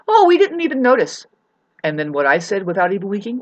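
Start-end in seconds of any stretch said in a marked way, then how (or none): 0.1-1.3 high-pitched mock reply; imitating the neighbor. Retelling a neighbor's reply to an apology, mock high voice. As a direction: voice her airily high, in imitation. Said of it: "you know what she said, like, without even winking".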